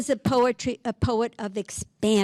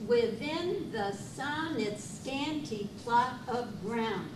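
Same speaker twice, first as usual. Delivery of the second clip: hushed rereading; 7 dB quieter, slower than usual. Acted. Quiet rereading of the sonnet.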